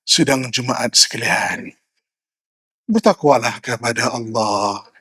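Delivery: slow, low, droning khutbah; drowsy, soft, dragged-out preaching; sleep-inducing sermon. Shown as a demonstration. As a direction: drone the sermon sluggishly enough to put worshippers to sleep. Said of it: "Bukan saja jemaah tidur".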